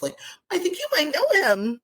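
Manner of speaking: high, coy imitation of a friend; mock coy announcement. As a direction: drop the news coyly, rising at the end. Acts friend breaking the news.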